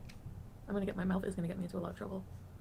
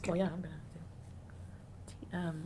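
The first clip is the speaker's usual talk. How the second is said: ordinary delivery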